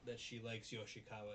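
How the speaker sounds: usual speaking voice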